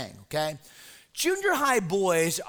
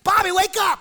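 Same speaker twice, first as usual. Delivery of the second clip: much higher and louder, calling out; mock wake-up shout; 10 semitones higher, 6 dB louder than usual. Pastor calling the sleeping boy awake, loud high shout.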